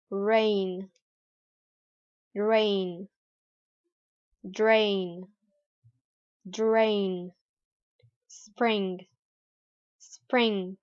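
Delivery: "rain", "drain", "spring" with a curled American R; American rolled-back R. Shown as a demonstration. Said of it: "rolling movement of the tongue".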